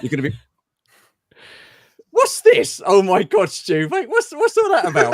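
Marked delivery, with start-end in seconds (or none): 2.1-5.1 surprised. Real, not acted. Surprised by a video guest.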